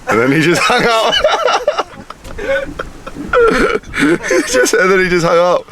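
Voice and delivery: high, helpless laughter breaking up the words; cracking up. Real, not acted. Friend retelling the abrupt phone call, cracking up. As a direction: laugh so hard you must restart the sentence.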